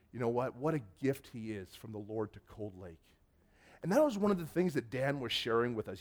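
ordinary delivery

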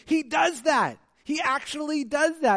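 loud, high-pitched excited exclamation; amazed outburst. Acted. Preacher mimics amazed readers of the story, excited. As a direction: blurt it out, astonished and thrilled.

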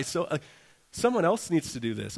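ordinary talk, nothing marked

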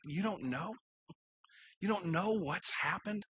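soft, questioning, imitated heartbreak; brokenhearted disbelief. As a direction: ask it softly, as if stunned by grief. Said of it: "you see the, the brokenheartedness".

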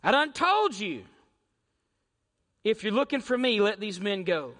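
lightly voiced, commanding Jesus; firm Jesus. Jesus telling soldiers he is the one, firmly. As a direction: take charge of the soldiers. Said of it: "And he said".

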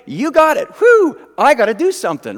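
loud, high flip from handing over to taking over; back and forth. Acted. Believer swinging between trust and fixing it, loud and high. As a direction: exaggerate the frantic wavering.

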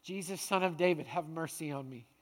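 soft, low, subdued prayer; humble, hushed appeal for mercy; quiet plea. Acted. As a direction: let the plea sink to a hush. Same plea, now said as prayer.

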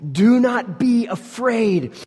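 high, slow, loud shout; a slow booming command. Shown as a demonstration. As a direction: proclaim it to the whole room. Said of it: "most repeated command".

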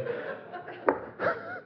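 airy, high unvoiced chuckle; quiet disbelieving laugh; breathy laugh. Real, not acted. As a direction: laugh it off under your breath. Laughing at the pilot's understatement.

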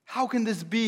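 can't believe what they're seeing; disbelief. Acted. A preacher voicing guests, rising disbelief.

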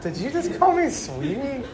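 can't believe she said that; indignant disbelief. Retold or imitated. To her cousin, indignant and incredulous.

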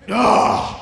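loud exasperated "ugh"; short, explosive groan of annoyance; frustrated groan. Acted. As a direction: let out a burst of frustration. Venting frustration at noisy roommates.

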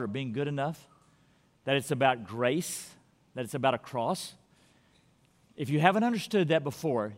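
soft, low pastoral appeal; gentle altar call. Real, not acted. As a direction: invite them quietly and earnestly. Quiet altar invitation.

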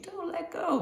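put-on tiny, very high child's voice; little kid plea. Small kid learning to ride, pleading in a high little voice. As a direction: squeak it like a frightened small child. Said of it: "Where the kid's like".

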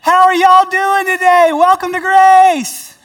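loud, high, hearty Southern welcome; Southern greeter impression. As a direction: boom out a friendly Southern hello. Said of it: "he says things like".

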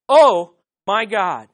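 loud, slow OH, then MY GOD; weighty, drawn-out OH; emphatic exclamation. Shown as a demonstration. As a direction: proclaim OH, pause, then MY GOD. Repeats the taught exclamation.

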